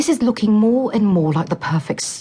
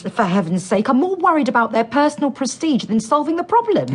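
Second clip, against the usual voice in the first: pitched up, indignant and heated; heated outburst; 7 semitones higher than usual. Acted. Scientist berating the director, heated and high. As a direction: rail against selfish scientists. Heated argument over funding.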